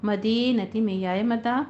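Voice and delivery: usual speaking voice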